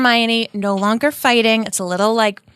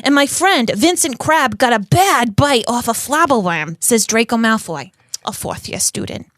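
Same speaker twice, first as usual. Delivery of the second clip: put-on theatrical New York accent; Rita Skeeter. Rita quoting students in her article, New York accent. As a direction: read the quotes and claims in a hammed-up New York accent. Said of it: "Rita has a New York accent".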